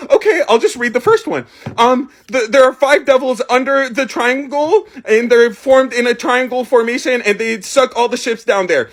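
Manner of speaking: put-on nervous kid reading. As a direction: imitate a child stumbling through cue cards. Nervous kid reads jumbled cue cards.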